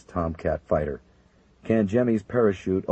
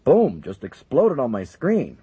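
surprised; 7 semitones higher, 6 dB louder, slower than usual. Acted. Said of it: "the surprised controller".

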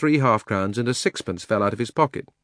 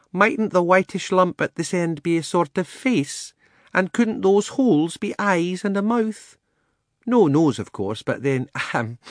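genteel elephant voice; 6 semitones higher than usual. Acted. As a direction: speak like a proud matron. Elephant speaks in story.

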